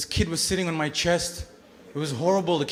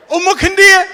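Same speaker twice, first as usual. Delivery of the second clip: high loud mocking taunt; taunting playground yell; 13 semitones higher, 12 dB louder than usual. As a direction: yell it like a kid bully. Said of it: "kids used to do this thing to me".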